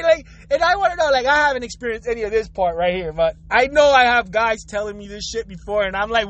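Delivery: ordinary talk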